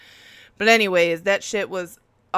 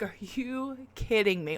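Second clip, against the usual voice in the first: hushed, softer, slower whisper of disbelief; 2 semitones higher, 7 dB quieter than usual. Retold or imitated. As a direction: breathe it out in stunned disbelief. Disbelief at trucks outside.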